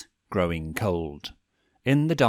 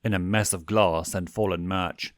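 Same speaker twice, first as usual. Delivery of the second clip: plain reading voice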